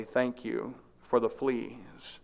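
calm as a prayer, unbothered; serenely. A narrator's reading. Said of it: "Then Betsy went on serenely".

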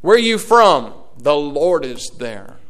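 ordinary delivery

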